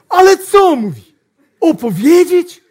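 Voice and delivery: hammed-up startled salesman; surprised salesman. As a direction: yelp it in disbelief. Salesman surprised, high loud.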